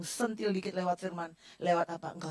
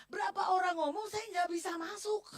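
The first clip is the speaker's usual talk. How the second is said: crying out, voice breaking high; suara menangis; 10 semitones higher than usual. Real, not acted. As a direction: sob the words out as the pain spills over. Preacher breaks down recalling pain. (berteriak sambil menangis)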